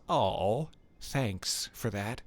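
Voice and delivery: ordinary delivery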